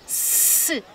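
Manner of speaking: "S" as a long hiss, not the letter name; isolated speech sound. Shown as a demonstration. Teacher isolating the shared first sound, "S" as long hiss. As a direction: sound out "S" as a held sss. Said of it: "Começa pelo mesmo som".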